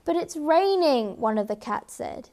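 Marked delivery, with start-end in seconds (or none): 0.0-1.2 higher, plaintive cat objection; protesting. A narrator's reading. A cat objects to fetching the king's food, protesting. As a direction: protest with a high start that falls away.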